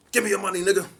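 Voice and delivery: high, loud robbery demand; menacing robber. Retold or imitated. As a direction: demand the money like a mugger. Robber demand voiced in story.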